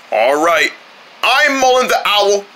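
usual speaking voice

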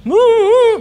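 loud, high joyful whoop; a high, loud cheer bursting out; excited whoop. Real, not acted. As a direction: let out a big whoop of delight. Excited whoop mid-chat.

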